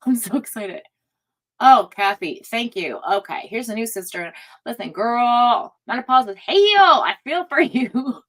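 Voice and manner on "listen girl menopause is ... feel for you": lightly voiced sassy friend, slow and louder; imagined viewer's voice. Voicing a viewer about menopause, lightly sassy. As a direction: play the viewer as a sassy girlfriend. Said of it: "that's how I imagined it".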